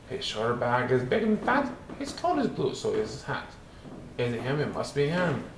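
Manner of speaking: ordinary delivery, nothing marked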